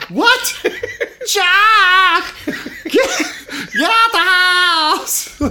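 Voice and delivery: high shrieking mock scream; screaming mom. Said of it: "screaming Ruby Falls".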